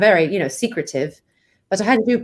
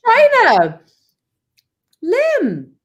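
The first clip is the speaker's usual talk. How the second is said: put-on British accent; 10 semitones higher, 5 dB louder, much slower than usual. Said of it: "I know you love my British accent".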